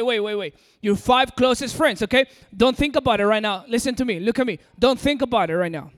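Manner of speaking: ordinary talk, nothing marked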